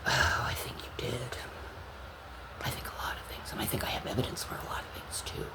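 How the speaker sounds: soft, voiceless, threatening hush; hushed warning to the accused coworkers; menacing whisper. Real, not acted.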